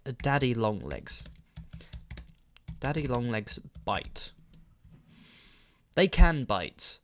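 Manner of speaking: ordinary delivery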